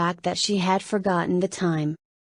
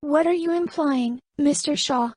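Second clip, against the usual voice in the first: higher, firm challenging woman's question; 7 semitones higher than usual. Acted. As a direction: stand your ground, higher voice. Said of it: "Camelia asked firmly".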